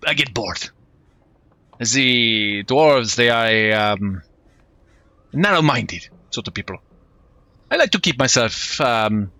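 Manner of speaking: put-on lightly French-accented, easygoing mercenary; a breezy hint of a French rogue; French swashbuckler. The mercenary chats with Thomas on deck in French accent.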